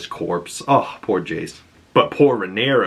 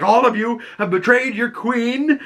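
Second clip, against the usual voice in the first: much higher, accusing mock cry; imitating furious queen; 13 semitones higher than usual. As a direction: hurl it at the whole room. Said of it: "she yells at everybody in the room".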